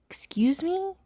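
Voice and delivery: high, rising incredulous question; mock-offended disbelief, pitch swooping up. Disbelief at friend seeing her ex.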